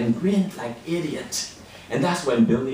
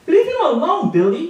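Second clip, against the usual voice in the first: high, loud demand; raised-voice defence of a friend; standing up; 13 semitones higher, 5 dB louder than usual. Acted. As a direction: push it out high and forceful at a bully. Standing up to the bully.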